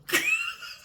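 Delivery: short, high burst of laughter; high squealing laugh. Real, not acted. Vlogger laughing at New York's brush-off, high squealing laugh. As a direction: squeal with delight at the reply.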